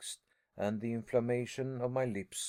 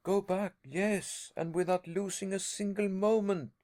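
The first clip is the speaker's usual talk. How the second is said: vehement cry; 7 semitones higher than usual. A narrator's reading. Harry to his uncle, vehement cry to go back. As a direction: demand it with urgency. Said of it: "I vehemently cried".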